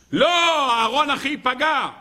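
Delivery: a sudden anxious no; pleading protest. Acted. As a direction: Moses blurting out his worry. Moses protests in retold dialogue.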